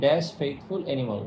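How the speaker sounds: very slow, word-by-word reading; slow dictation. Shown as a demonstration. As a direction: read it out slowly for the class to follow. Teacher reads exercise sentence aloud. (ধীরে ধীরে বাক্য পড়া)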